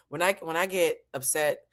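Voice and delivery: usual speaking voice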